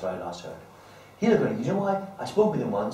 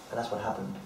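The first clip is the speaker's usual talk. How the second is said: usual speaking voice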